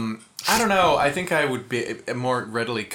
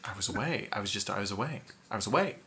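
softer, low-pitched protest; subdued mock alibi; 4 semitones lower, 9 dB quieter, much faster than usual. Suspect answering where he was at the time, subdued mock alibi. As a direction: repeat the alibi softly and low.

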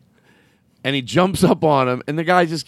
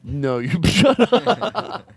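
laughing; 4 semitones higher, much slower than usual. Real, not acted. Laughing at friend.

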